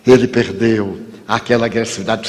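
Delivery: ordinary talk, nothing marked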